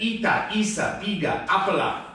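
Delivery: Ita, isa, biga, apala with an extra a after each word; vowel tacked onto every word end, Ita isa biga apala; adding vowels. Retold or imitated. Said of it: "But she said".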